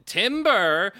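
high, loud drawn-out battle cry; questioning shout. Acted. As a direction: shout it slowly like an unsure question. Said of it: "he shouts again".

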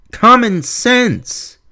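slow exasperated plea. Real, not acted. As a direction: spell it out in disbelief. Exasperated rant.

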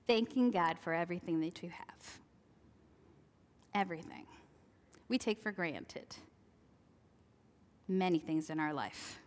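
ordinary talk

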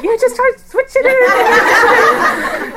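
high, slow, laughing encouragement; laughing coax. Retold or imitated. Mother laughing as she coaxes her three-year-old. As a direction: coax her through giggles. Coaxing child to catch spider.

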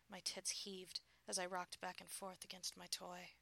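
ordinary delivery, nothing marked

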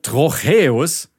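Trocheus slow, stressed syllable drawn out; stress demo. Shown as a demonstration. Explaining meter to a friend, slowly stressing Trocheus. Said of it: "hat die Betonung auf der ersten Silbe".

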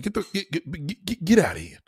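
whispered, stumbling get, get; a hushed, halting threat; stuttered whisper. Shown as a demonstration. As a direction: breathe it out with a stutter. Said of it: "You gotta put the stutter in there".

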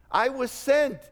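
loud, high proclamation rising to He's the one; proclaiming John. Retold or imitated. Preacher voicing John the Baptist, loud proclamation. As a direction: announce the Messiah like a herald.

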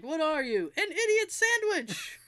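high, rising mock taunt; mock chef tirade. Host acts chef with two pieces of bread, mock taunt. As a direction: climb high into a stretched-out mock answer. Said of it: "he took two pieces of bread, and he's like".

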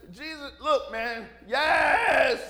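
a touch of an exasperated outburst on "yes"; mock annoyed [name] snapping back; [name], fed up at being asked again, mildly exasperated. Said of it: "even [name]'s getting upset".

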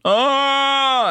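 long, high, loud held moan; patient's wailing moan. Retold or imitated. Imitating the man moaning all night in hospital, high wail. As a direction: wail one drawn-out note like a needy patient. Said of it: "that's what he would do".

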